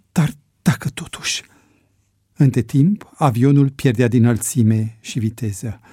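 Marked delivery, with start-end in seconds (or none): none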